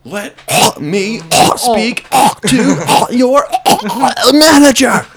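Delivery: high, slow, loud Karen demand; entitled customer. Pretend. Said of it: "Are you Karen complaining".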